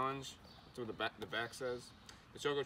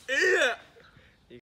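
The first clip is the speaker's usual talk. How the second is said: loud high yell to eat; shouting; 14 dB louder than usual. Real, not acted. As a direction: bark the order at full volume. Egging friend to eat pepper.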